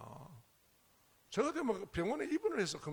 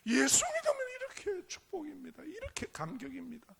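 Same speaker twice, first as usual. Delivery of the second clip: high, near-tearful cry of joy, then settling; overflowing joy; 9 semitones higher, 5 dB louder than usual. Retold or imitated. Preacher voicing the urge to tell bathhouse men about Jesus, tearful joy. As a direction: let the urge to tell everyone burst out. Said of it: "저보고 미쳤다 할까 봐 제가 억제했습니다".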